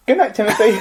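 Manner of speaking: sweet parent signing off; cheery bedtime voice. Pretend. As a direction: say goodnight like a parent. Mock bedtime story ending.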